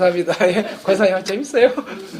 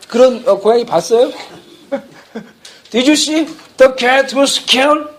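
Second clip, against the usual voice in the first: ordinary talk, nothing marked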